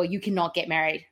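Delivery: ordinary delivery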